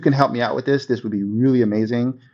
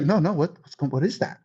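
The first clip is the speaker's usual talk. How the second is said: softer, surprised question; curious friend; 2 semitones higher, 5 dB quieter than usual. Retold or imitated. Friend hooked by the tip, softly surprised. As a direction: ask like you're hooked. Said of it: "they're gonna be like".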